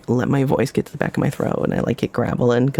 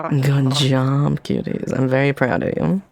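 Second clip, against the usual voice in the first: ordinary talk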